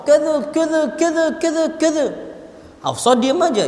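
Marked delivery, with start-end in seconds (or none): 0.0-2.1 rapid-fire mock telling-off, pitched high; imitated scolding. Umar telling off his daughter Hafsah, mock scolding. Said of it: "Dimarahi Hafsah".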